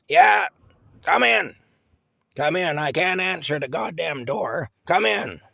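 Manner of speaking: put-on high, loud crabby old woman hollering; cranky old lady yelling. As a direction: yell it out like a grouchy half-blind old woman. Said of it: "she'd yell".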